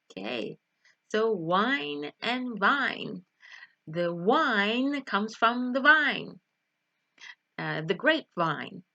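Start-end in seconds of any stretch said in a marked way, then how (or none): 1.3-6.4 slow "wine" and "vine", w rounded, v buzzed; contrasted W and V. Shown as a demonstration. Teacher contrasting two confusable words, slow and emphatic. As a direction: lean slowly on "wine" and "vine" to set the first sounds apart. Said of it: "there is a very different sound".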